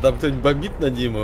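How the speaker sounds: ordinary delivery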